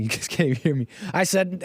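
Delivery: ordinary talk, nothing marked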